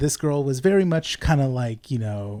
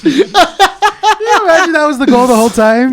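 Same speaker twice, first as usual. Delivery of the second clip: laughing; 10 semitones higher, 13 dB louder than usual. Real, not acted. Laughing loud excitement.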